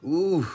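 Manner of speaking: a high, loud cry of ooh; pained ooh. Real, not acted. Preacher crying out over Joseph's pain, high ooh. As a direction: let out a sharp pained ooh.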